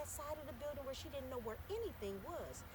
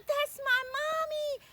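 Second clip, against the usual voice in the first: very high, loud calling out; a child crying out to her mother; shouting, tearful joy; calling for mommy; 11 dB louder, much slower than usual. Acted. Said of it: "she could see her mother".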